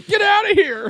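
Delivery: high, loud bratty yell; mock shout. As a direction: bark at the intruder like a teen.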